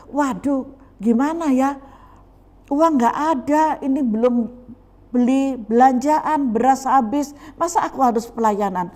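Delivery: high, loud mock fretting; acted worried complaining. Preacher mimics servant fretting over money, high worried complaint. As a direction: fret aloud like a reluctant church worker.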